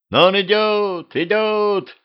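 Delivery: put-on high, calling boy's shout from a rooftop; excited lad shouting to the village. A narrator's reading. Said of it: "закричал пацан с крыши таверны".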